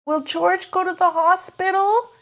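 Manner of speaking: put-on high little girl question; Peppa voice. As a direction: ask like a worried little girl. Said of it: "asks Peppa".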